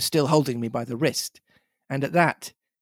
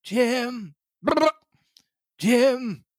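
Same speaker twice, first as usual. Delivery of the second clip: put-on higher old castaway voice savouring a name; Ben Gunn voice; 9 semitones higher than usual. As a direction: repeat the name like a pleased old hermit. Ben Gunn voiced in read story.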